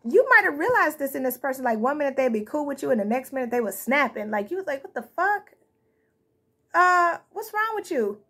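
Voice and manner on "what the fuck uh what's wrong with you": high-pitched, baffled retold reaction; acted disbelief, pitched up; mock bewilderment. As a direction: imitate a taken-aback, what-is-your-problem response. Acts out reaction to someone snapping.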